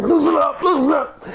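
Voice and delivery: very high, repeated plea; Coco. Retold or imitated. Coco trapped by Andre, imitated high-pitched pleading. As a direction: squeal it like someone held in a headlock. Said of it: "I hear".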